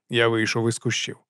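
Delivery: plain reading voice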